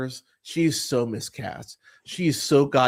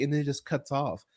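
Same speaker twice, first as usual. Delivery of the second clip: usual speaking voice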